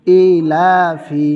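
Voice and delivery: slow, loud model reading of ইলাফি; drawn-out recitation. Shown as a demonstration. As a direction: spell out ইলাফি slowly like a teacher's model reading. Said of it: "ফায়ের নিচে জের হবে".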